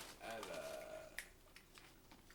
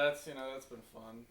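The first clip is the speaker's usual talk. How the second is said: usual speaking voice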